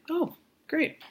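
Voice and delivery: high, mock-cheerful sarcastic "Oh, great"; imitating the professor's dry, unimpressed reply.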